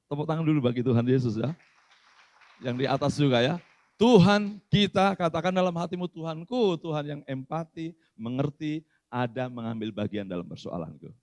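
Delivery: loud preaching call to the church; rally the congregation loudly; fervent preaching. Acted. Pastor leads church to pray aloud.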